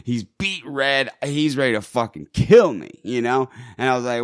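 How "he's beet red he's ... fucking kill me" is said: ordinary delivery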